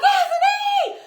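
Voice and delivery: re-enacted high, drawn-out scream for help; panicked call across the house, acted out; screaming for husband. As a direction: scream the name long and high. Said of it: "start screaming for my husband".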